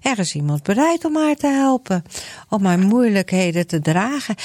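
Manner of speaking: ordinary delivery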